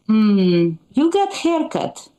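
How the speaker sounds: put-on skeptical mother in an Eastern European accent. Mother inspecting the new haircut, Eastern European accent. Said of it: "she'll be like".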